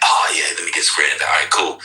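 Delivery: customer voice. Retold or imitated. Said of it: "He's like".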